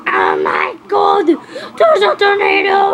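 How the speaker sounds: slow, drawn-out wailing alarm; panicked scream. Acted. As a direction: scream the warning in hammed-up terror. Panic as tornado is spotted.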